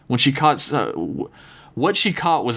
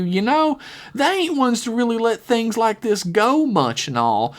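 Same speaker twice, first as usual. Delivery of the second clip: pleading, lilting high voice; high southern girl voice; 9 semitones higher than usual. Acted. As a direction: sweet-talk her, voice raised high.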